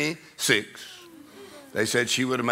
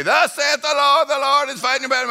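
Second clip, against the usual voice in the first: church prophecy interpreter; 14 semitones higher, 7 dB louder than usual. Retold or imitated. Imitating someone interpreting tongues in church, proclaiming. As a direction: declaim like a prophet to the congregation. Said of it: "somebody would interpret it and say".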